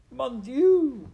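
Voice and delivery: very high, loud theatrical cry; mother's shout. A narrator's reading. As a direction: shriek it as an appalled French mother. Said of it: "shouted his mother".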